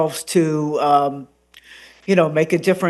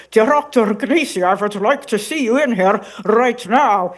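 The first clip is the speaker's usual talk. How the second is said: a hint of an older Norwegian woman's accent; Norwegian therapist voice; 4 semitones higher than usual. Shown as a demonstration. Therapist calling Doctor Gnisi in, light Norwegian grandmother accent. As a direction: summon him like a stern Norwegian grandmother. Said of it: "she'll say".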